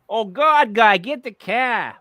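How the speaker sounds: mock Boston accent, loud and high, car with no r; hamming up a thick Boston accent. Host mocking Massachusetts speech, mock Boston accent. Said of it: "We're not Massachusetts, which is".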